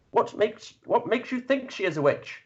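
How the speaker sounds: high, probing knightly question; knight's voice. Acted. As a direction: press them like a patient knight.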